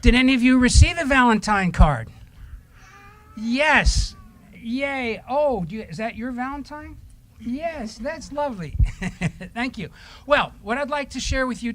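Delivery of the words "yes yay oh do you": ordinary delivery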